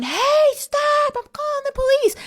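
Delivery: very high imitated yell; high-pitched retold shout, held back in volume; mock yelling. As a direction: imitate a woman yelling after someone running off. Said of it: "she's at the front door yelling".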